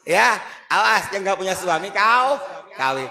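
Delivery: loud teasing warning; mock scolding. Preacher mocking a nagging mother, loud and teasing. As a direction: bark it out like a scolding aunt.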